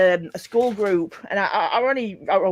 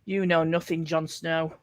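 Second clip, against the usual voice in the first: lower, softer mock character voice; film-quote impression; 5 semitones lower, 5 dB quieter than usual. Friend quoting at mention of Kit Harington, lightly acted low voice. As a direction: lightly imitate a famous line, low and quiet. Quotes a Game of Thrones line.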